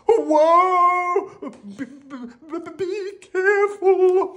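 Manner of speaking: very high panicked wail; stammer, then stretch the warning out high; frightened squeal with stuttered start; anxious stammer. Acted. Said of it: "Rocky said anxiously".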